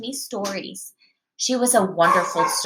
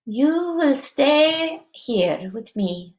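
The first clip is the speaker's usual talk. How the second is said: slow, higher witchy grandmother; witch voice; 5 semitones higher, much slower than usual. Acted. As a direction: draw it out slowly like a witch. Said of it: "That's a witch voice".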